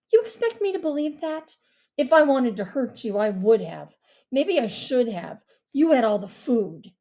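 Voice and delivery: angry retort. Acted. Mia angry reply.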